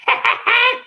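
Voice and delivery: a high squealing squirrel call; squeaky monkey noise. Acted. Imitating squirrels for the crowd with a high squeal.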